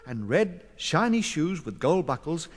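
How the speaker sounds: ordinary delivery, nothing marked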